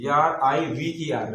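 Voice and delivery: R-I-V-E-R spelled out slowly; slow spelling. Shown as a demonstration. Teacher spelling RIVER for pupils, slow and distinct. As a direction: space each letter clearly. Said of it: "spelling river for pupils".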